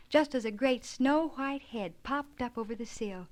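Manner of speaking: usual speaking voice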